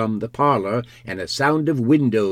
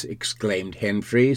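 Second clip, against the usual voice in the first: plain reading voice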